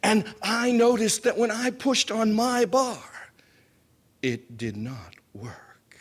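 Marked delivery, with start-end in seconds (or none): 4.2-6.0 slow unvoiced whisper of dread. Recalling the broken bar to the audience, whispered slowly. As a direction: let the fear drop to a whisper.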